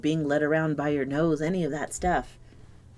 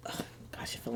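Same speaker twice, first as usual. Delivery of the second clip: much softer whispered exclamation; whispering; 14 dB quieter, faster than usual. Real, not acted. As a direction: whisper it in surprise. Card falls on the floor.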